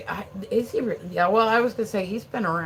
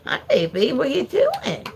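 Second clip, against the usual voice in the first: pet voice; 8 semitones higher, 5 dB louder than usual. Real, not acted. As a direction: coo at the puppy. Greeting the dog.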